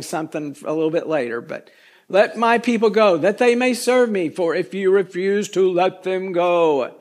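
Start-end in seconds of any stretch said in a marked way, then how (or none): 2.1-7.0 raised, solemn voice of God. Acted. Preacher reads the Lord's command to Pharaoh in a God voice. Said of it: "I say it in a God voice".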